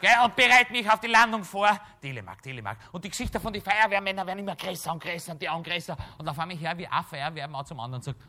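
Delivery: usual speaking voice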